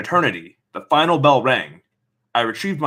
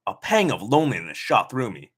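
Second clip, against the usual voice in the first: ordinary delivery, nothing marked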